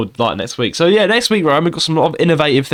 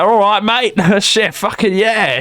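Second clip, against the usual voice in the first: mock Australian accent, higher and drawn out; exaggerated Aussie impression, pitched up; [name]; 7 semitones higher, much slower than usual. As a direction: do a slow, hammed-up Australian voice. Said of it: "He's got the Australian".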